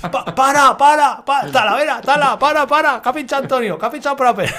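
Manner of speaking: high, loud, stammering shout to stop; frantic yell. Retold or imitated. Rider yelling to the group that a teammate punctured, frantic and high. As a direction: yell for the group to stop, tripping over the words.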